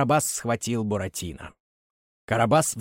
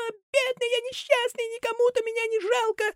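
very high, thin puppet whine; thin little voice. Acted. Buratino whining to Karabas in a thin voice. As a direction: whimper it like a pitiful little puppet. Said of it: "тоненьким голосом".

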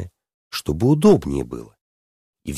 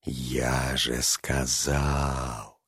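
very slow, low, soft dragon voice; cunning dragon, hushed and drawn out; sly lisping dragon; 6 semitones lower, 8 dB quieter, much slower than usual. A narrator's reading. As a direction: stretch each word low and quiet, slyly. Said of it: "хитро шепелявым голосом выговорил дракон".

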